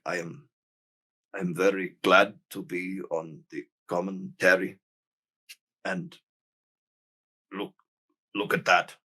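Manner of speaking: put-on soft, lightly Japanese-accented English; halting, softly accented English. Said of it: "doesn't speak English very well".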